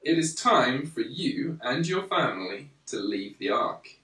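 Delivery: ordinary delivery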